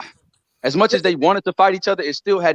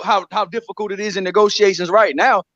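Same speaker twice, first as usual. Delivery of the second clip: ordinary delivery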